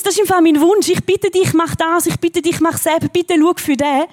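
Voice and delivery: hammed-up wish-list prayer; pleading prayer. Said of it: "dann kommt aber gerade das Problem".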